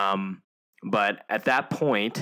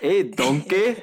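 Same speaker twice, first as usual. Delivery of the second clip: slow, higher, laughing Scottish greeting; Shrek accent; 7 semitones higher, 4 dB louder, much slower than usual. Acted. Host retrying Shrek for laughing friends, slow Scottish accent. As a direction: drag out the ogre's greeting through a laugh. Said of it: "do the Shrek bit".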